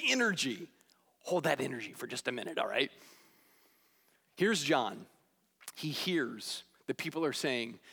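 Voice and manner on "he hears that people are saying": normal talking voice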